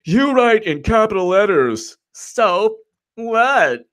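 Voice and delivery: high put-on scolding voice; mocking complainer. Mocking viewers who object to capital letters, high and exaggerated. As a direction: mimic a critic in a high caricature. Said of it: "that's why people were like".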